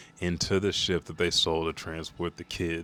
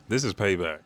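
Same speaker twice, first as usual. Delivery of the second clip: ordinary talk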